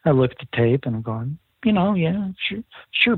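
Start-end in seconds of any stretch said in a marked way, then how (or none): 1.6-3.2 higher, hesitant "yeah, sure, sure"; nervous reassurance. Retold or imitated. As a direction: cover your doubt with quick, nervous agreement. Nervously reassuring Bob about tape.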